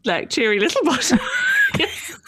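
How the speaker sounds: the pun laughed out, rising on little; laughing at the pun. Real, not acted. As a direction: say cheery little bottom while laughing, with little stressed.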